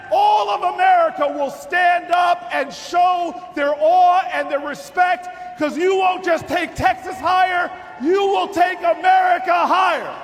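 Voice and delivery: high, loud campaign roar; preaching at full force. Acted. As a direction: shout it to the whole hall. Climax of campaign speech.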